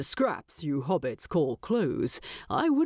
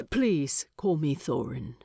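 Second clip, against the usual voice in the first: usual speaking voice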